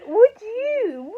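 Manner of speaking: put-on loud high drunk question; drunken shout. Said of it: "You were going".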